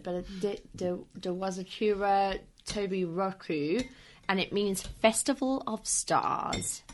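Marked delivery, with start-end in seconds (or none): none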